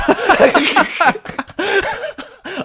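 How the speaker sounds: high, helpless laughing fit; real laughter. Real, not acted. Host cracking up at own Bromwell quote, laughing. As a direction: crack up completely.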